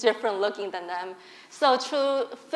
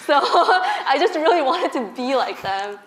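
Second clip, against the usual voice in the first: laughing; 4 semitones higher, 4 dB louder than usual. Real, not acted. Laughing at own teenage self.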